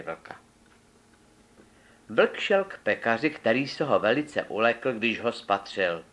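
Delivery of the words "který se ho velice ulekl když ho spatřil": usual speaking voice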